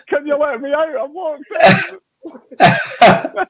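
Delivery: high, small plaintive voice calling out, dragged slow at the end; a trapped wee man pleading to be let out; Jim Spence wee voice. Retold or imitated. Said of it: "this wee voice goes".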